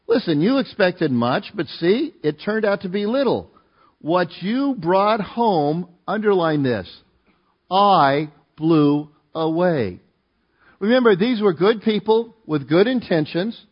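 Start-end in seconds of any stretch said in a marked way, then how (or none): 4.0-5.9 "What you brought home" very slow, each word weighted; slow, spaced-out "What you brought home"; drawn-out emphasis. Real, not acted. Reading a verse with heavy emphasis. 7.7-10.0 "I blew away" very slow, a gap after each word; drawn-out, weighty "I... blew... away"; word-by-word emphasis. Real, not acted. Key verse words he has underlined.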